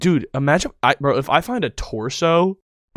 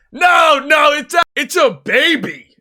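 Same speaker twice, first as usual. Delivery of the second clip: high, loud hammed-up yell; mock shout; 7 dB louder than usual. Finder shouting the baby news to friends, a hammed-up high yell. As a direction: yell it out like a cartoon alarm.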